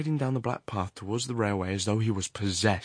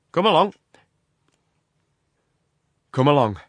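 loud, higher-pitched burst; sudden command; 6 semitones higher, 11 dB louder than usual. A narrator's reading. Horrocks suddenly urges his companion down the path, loud command. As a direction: urge him on abruptly and loudly. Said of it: "he said suddenly".

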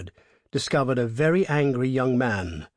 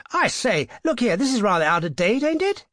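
higher, louder, incredulous gentleman's voice; bluffing young toff; 10 semitones higher, 5 dB louder than usual. A narrator's reading. Motorist protesting to the highwayman, high and incredulous. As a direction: laugh it off as an outrage.

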